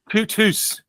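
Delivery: "Tutus" blended Yorkshire and Scots; Yorkshire-Scots mix. Shown as a demonstration. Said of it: "the Yorkshire accent and then outhouse with a Scottish accent".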